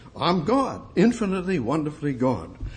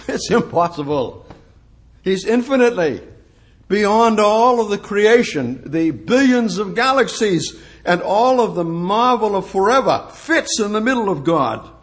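louder, higher, rising awe; preacher proclaiming God's vastness, louder; building preaching; 5 semitones higher, 4 dB louder than usual. Real, not acted. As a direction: swell into the vastness of God.

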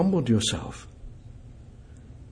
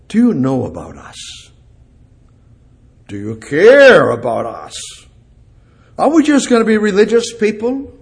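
much higher and louder, pleading with God; impassioned preaching; 6 semitones higher, 6 dB louder than usual. Acted. Preacher challenges congregation and God, loud and impassioned. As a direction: cry out to heaven.

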